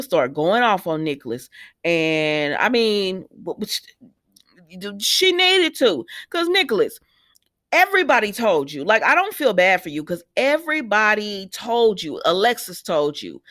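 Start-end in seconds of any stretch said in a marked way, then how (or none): 5.0-8.8 fired up. Real, not acted. Viewer fired up at the character.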